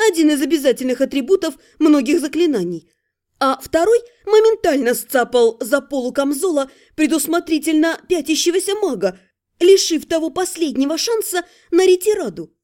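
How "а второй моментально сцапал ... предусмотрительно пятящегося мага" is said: plain reading voice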